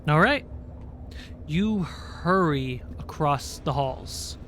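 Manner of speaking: normal talking voice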